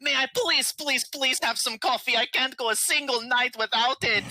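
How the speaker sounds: high, desperate pleading voice; whiny beg for coffee; begging. Acted. Pleading character begging on knees.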